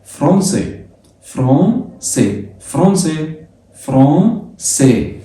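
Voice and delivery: فرانسي as 'fron-se', alternating joined and drawn out; correct French pronunciation. Shown as a demonstration. Teacher drilling the correct option, nasal 'fron-se'. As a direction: drill فرانسي with nasal 'on', quick then slow. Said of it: "النطق التاني أنت صح".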